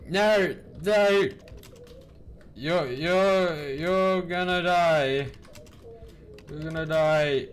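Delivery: high, loud, stretched wail; drawn-out, playful dread; mock panicked warning. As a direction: warn in a hammed-up high cry. Refusing sister a lift, mock panic.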